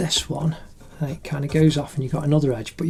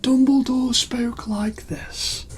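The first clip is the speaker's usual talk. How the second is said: high breathy whisper, slow; Dumbledore whisper; 9 semitones higher, slower than usual. Retold or imitated. Parent reading Harry Potter to the kids, whispered. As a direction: whisper it as the old wizard. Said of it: "And I read".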